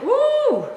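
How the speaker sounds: a sudden high squeal of delight; high delighted ooh. Real, not acted. Cook squealing at her jalapeno, real excited cry. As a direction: squeal like the heat just hit. Reacting to the pepper.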